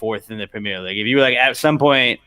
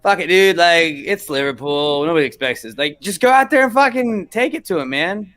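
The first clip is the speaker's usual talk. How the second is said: hyped underdog pep talk, higher pitched; mock locker-room fighting talk; fired-up bravado; 5 semitones higher, 3 dB louder than usual. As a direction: imitate players psyching each other up. Voicing underdog team's bravado.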